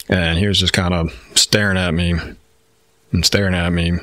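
ordinary delivery

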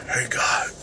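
whispered villain impression; soft, voiceless horror-villain greeting; Jigsaw. Vlogger imitating a film villain, whispered greeting. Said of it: "sounds like Jigsaw".

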